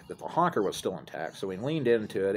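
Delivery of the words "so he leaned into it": ordinary talk, nothing marked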